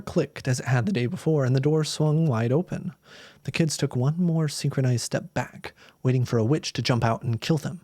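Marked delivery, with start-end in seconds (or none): none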